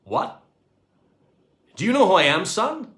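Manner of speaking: loud, climbing high on son; indignant squawk. A narrator's reading. Astronaut offended at the cleaner, loud squawk. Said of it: "he squawked".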